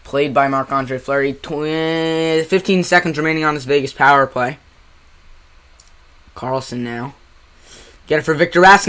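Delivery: live hockey call, brisk; play-by-play commentary. Acted. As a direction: call the game like a broadcaster.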